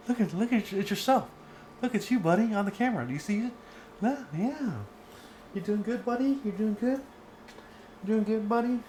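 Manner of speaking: high, sing-song pet talk; dog-talk voice. Real, not acted. Teacher cooing to her sleepy dog on camera.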